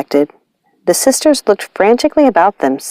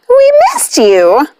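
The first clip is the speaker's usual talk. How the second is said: high, slow, delighted call; excited witch; 13 semitones higher, slower than usual. Acted. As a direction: stretch it out like a happy cackling witch. Witch greets her cat gleefully.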